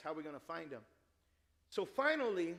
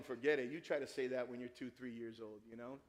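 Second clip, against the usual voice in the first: ordinary talk, nothing marked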